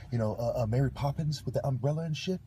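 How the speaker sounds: usual speaking voice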